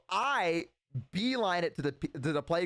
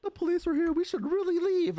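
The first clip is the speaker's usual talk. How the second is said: put-on much higher, worried kid urging to leave; nervous friend; 4 dB louder than usual. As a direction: pitch way up like a scared kid. Nervous friend voiced in story.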